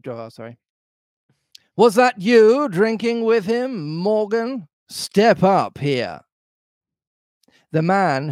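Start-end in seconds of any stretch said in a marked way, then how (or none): 1.8-6.2 high questioning pirate, slowing into an order; Long John Silver. A narrator's reading. Landlord calling Morgan to account, high commanding pirate voice. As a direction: summon the sailor as the landlord. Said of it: "the voice of Long John Silver".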